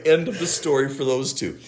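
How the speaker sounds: usual speaking voice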